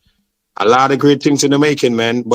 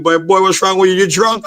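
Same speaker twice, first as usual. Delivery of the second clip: higher, teasing mock concern; 6 semitones higher than usual. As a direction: tease him like he's drunk. People laughing at him.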